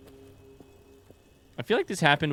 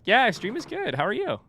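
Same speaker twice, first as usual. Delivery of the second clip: cheery reply; 10 semitones higher than usual. Real, not acted. Answering raider.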